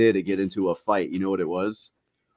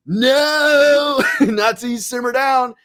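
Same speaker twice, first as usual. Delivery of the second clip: much higher, louder, laughing yell; laughing shout; 13 semitones higher, 8 dB louder, much slower than usual. Retold or imitated. Holding his drunk friend down, shouting through laughter. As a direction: yell it while cracking up.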